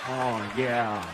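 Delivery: low, loud, slow sultry moan; husband pretending his wife turns him on; mock lustful husband. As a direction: ham up the aroused husband. Mock husband lusting after wife.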